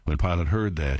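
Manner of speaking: usual speaking voice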